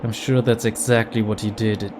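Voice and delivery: ordinary delivery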